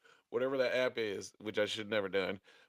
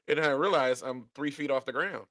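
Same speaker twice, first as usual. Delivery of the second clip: ordinary delivery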